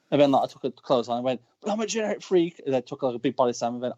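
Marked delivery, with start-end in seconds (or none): 1.6-2.6 high-pitched wrestling promo voice; Scott Steiner promo. Retold or imitated. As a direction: rattle it off as a wrestler cutting a promo. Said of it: "doing the whole Scott Steiner promo".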